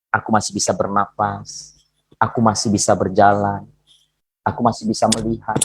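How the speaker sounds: low, soft, grateful prayer; humble prayer. Shown as a demonstration. Preacher showing listeners how to thank God for small things, humbly.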